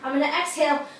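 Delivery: ordinary delivery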